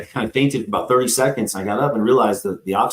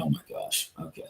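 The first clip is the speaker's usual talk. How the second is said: whispered to himself; 7 semitones lower, 16 dB quieter than usual. Retold or imitated. Thinking to himself in the ER.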